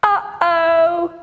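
lightly worried sing-song uh-oh; a playful worried uh-oh. Shown as a demonstration. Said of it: "Can you do an uh-oh?".

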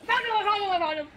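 high, loud triumphant cry; excited shout. Real, not acted. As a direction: yell it out with glee. Spots hidden monster.

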